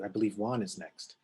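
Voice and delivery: normal talking voice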